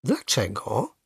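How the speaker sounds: soft, puzzled question; mildly surprised. A narrator's reading. Grandfather answers the boy's cry, mildly surprised. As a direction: ask with understated surprise. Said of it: "zdziwił się dziadek".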